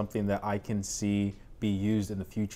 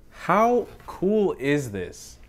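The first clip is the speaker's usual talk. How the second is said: excited; 7 semitones higher, much slower than usual. Real, not acted. Excited question.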